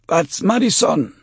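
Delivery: high, loud strained croak; strangled high tout voice; pained croak. Acted. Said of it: "he croaked".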